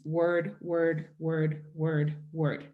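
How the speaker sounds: "word, word, word" spaced out, slow and separate. Shown as a demonstration. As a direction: say each "word" with a pause. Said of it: "we don't have a pause between each word".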